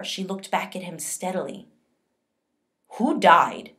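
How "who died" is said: loud, harsh demand; officer harshly questions a frightened girl, loud. A narrator's reading. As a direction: bark it at the girl. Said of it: "he asked harshly".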